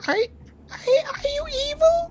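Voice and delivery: high, slow, frightened little voice; tiny scared plant voice. Acted. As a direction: whimper it like a tiny trapped kid.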